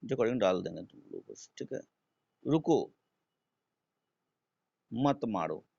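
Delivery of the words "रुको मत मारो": रुको, long pause, then मत मारो; comma after रुको. Shown as a demonstration. Teacher putting a comma in the example, long pause after रुको. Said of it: "comma दे दिए हम".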